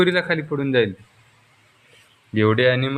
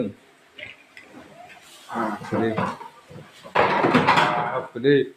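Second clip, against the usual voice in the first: mock amazement; 4 semitones lower, much slower than usual. Parent marvelling to toddler at the fallen elephant, drawn-out awe. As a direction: stretch the wonder out for the child. Elephant knocked over in play.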